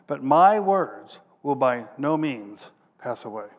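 preacher proclaiming Jesus' words on heaven and earth; scripture reading. Acted. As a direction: declare the verses with weight. Preacher reads scripture aloud.

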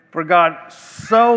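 slow, rising, with "so" drawn out and held; stress on so. Shown as a demonstration. Preacher corrects the misreading, stressing "so". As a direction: hang on the word "so". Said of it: "the emphasis is on that".

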